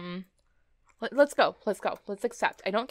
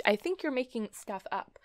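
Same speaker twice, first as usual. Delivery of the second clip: normal talking voice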